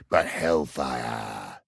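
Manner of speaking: low, slow and hushed; ominous. Acted. Man telling sir of a hellish hole, ominously. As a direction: draw it out softly, full of dread. Spooky tale of the devil's hole.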